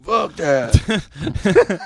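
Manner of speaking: high, drawn-out imitation; corrected singer imitation. Showing how the singer really sounds, high drawn-out imitation. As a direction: stretch it out in a high put-on voice. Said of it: "He's like".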